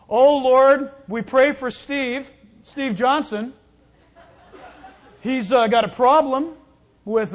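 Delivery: loud, overly pious prayer voice spilling a juicy secret; gossip disguised as prayer. Retold or imitated. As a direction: pray aloud for Steve while really spreading the news.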